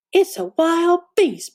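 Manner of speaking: slow, mild mock growl; a hint of a growl, lightly voiced; growling Papa Bear. A narrator's reading. Papa Bear spots Goldilocks, mild growl. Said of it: "Papa Bear growled".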